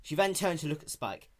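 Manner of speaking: ordinary delivery, nothing marked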